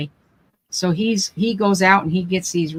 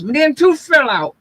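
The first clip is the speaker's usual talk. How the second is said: high, raised mumbled grumbling; mock muffled outburst, pitched up; imitated mumbled yell; 7 semitones higher, 4 dB louder than usual. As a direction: imitate him yelling in a mumble from the kitchen. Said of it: "he's mum-, mumbling".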